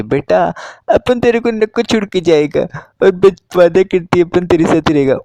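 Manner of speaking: high, loud mock motherly voice; mother's voice. Mother promising her young son, high hammed-up motherly voice. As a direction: promise the boy in a hammed-up mom voice. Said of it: "उसकी mummy ... बोलती है".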